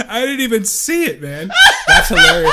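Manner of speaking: high-pitched, laughing it out; can't stop laughing at it. Real, not acted. Cracking up at the email.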